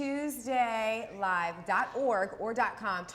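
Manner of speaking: TUESDAY LIVE DOT ORG spelled out word by word; very slow, deliberate web address; exaggeratedly slow. Shown as a demonstration. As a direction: stretch each word as if asked to slow down. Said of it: "Can you say it slower?".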